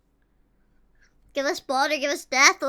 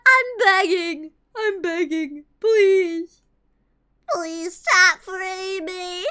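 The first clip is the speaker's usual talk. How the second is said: slow, pleading, whiny beg; mock begging; much slower than usual. Prisoner begging guard for release, mock whimper.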